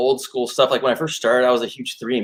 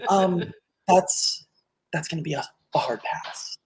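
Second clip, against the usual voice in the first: lightly voiced fussy disdain, dropping to a hushed hard pass; a touch of prissy refusal; David from Schitt's Creek; 4 semitones higher, 5 dB quieter than usual. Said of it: "do David from Schitt's Creek".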